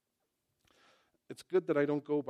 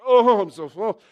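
much louder, higher, mock moan of a stuffed eater; overstuffed groan; 7 semitones higher, 16 dB louder than usual. Preacher acting himself after two meals, hammed-up full moan. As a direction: ham up being painfully full. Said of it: "You know, it's like".

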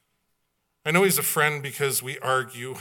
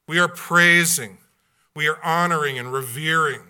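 louder, rising preacher's proclamation; full-force preaching; 4 semitones higher, 7 dB louder than usual. Acted. Pastor proclaiming to the congregation that we worship now, full-force preaching.

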